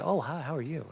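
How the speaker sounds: very soft mock-innocent greeting; fake-polite. Imitating a two-faced troublemaker greeting a stranger, soft fake politeness. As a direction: act meek and harmless, barely raising the voice. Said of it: "That's how they are, two-faced".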